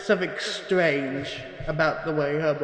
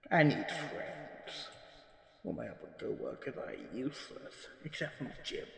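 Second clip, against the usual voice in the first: much softer, half-whispered admission; muttered aside; 9 dB quieter than usual. Acted. Lonely archivist quietly admitting to the recorder he needs friends.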